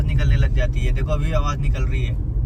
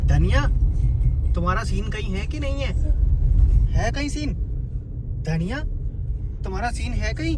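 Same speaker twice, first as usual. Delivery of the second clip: playful teasing; 6 semitones higher than usual. Real, not acted. Teasing the dog in high voice.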